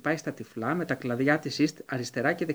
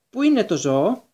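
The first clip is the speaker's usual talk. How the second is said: put-on a touch higher and louder, grating old voice; an old official pressing the question, high and sharp; shrill old wizard; 6 semitones higher, 6 dB louder than usual. A narrator's reading. Committee wizard asking for the animal, high and grating. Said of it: "η στριγγιά φωνή του γερομάγου".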